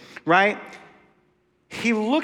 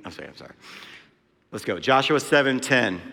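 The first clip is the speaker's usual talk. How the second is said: ordinary talk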